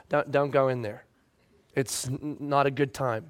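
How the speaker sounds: whisper-close caution; wife quietly warning him off the room; hushed warning. Retold or imitated.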